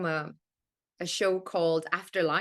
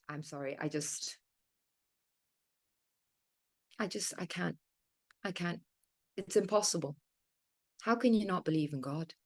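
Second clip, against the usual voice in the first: Kath exasperated; 6 dB quieter than usual. Acted. Guest as Kath, softly exasperated at the believer. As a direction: trail off, exasperated and deflated. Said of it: "It's the character Kath".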